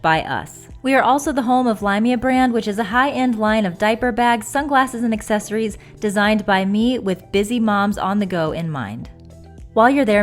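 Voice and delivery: upbeat sponsor read, loud. Acted. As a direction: read it like a commercial.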